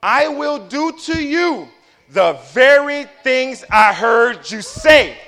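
slow, weighty and rising to a shout; each word heavy, building to a shout; read slow. Shown as a demonstration. Preacher to congregation, slow and building to a shout. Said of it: "I'm gonna read it slow".

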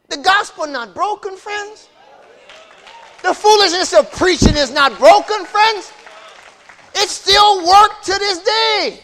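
loud, high, shouting sermon climax; full-force preaching. Acted. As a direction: whip the congregation up, shouting.

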